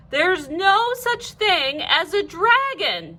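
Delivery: slow, firm and insistent; firmly. A narrator's reading. Mother refusing to admit the dragon, firm. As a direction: lay it down as fact, slowly. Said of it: "said Mother firmly".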